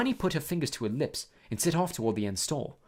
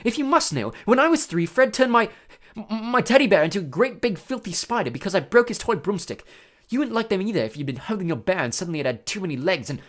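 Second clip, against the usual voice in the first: shuddering; 6 semitones higher, 5 dB louder than usual. A narrator's reading. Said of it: "He broke off, shuddering".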